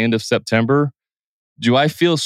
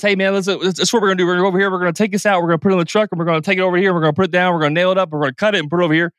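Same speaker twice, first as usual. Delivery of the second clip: rapid-fire, pitched up, words tumbling out; talking really fast; 6 semitones higher, much faster than usual. Shown as a demonstration. Contractor rushing a client, fast and high. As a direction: rattle it off fast like an overeager contractor. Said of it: "Are you talking really fast and like this?".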